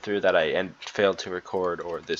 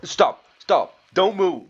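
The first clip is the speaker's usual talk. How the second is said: high, loud cries of alarm; urgent shout; 11 semitones higher, 9 dB louder, slower than usual. Acted. Crewman ordering wounded Daisuke not to move, urgent shout. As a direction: shout to halt someone at once. Acted emergency in game dialogue.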